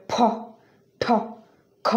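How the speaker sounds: "ফ, ঠ, খ" each with a big puff of air; exaggerated aspiration. Shown as a demonstration. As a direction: overdo the breath burst on "ফ, ঠ, খ". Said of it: "exaggerate ওয়েতে এইসবের উচ্চারণ করবেন".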